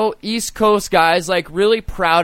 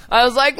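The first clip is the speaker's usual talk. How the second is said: high strangled laughing yelp; pained high yelp; 13 semitones higher, faster than usual. Retold or imitated. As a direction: squeal like it really hurt. Dick caught in zipper.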